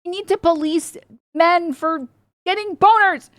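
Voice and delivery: high, mocking prissy voice, slow then rising; mock squeamish high voice; mocking prude. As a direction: sneer like a pearl-clutching prude. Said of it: "it's like".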